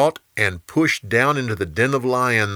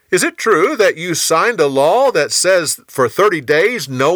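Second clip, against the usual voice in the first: high, louder leading question; scheming officials; 7 semitones higher, 5 dB louder than usual. Acted. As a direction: press the king in a raised, high voice.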